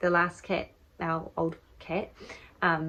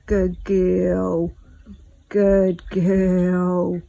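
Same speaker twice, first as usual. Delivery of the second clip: encouraging cat; 10 dB louder, much slower than usual. Real, not acted. Owner encouraging her labouring cat with loud praise. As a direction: cheer her on as she pushes. Coaxing cat through birth.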